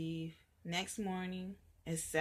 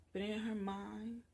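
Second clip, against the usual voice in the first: usual speaking voice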